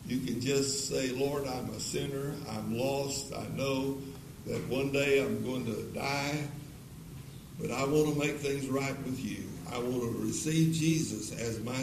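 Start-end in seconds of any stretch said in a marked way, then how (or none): none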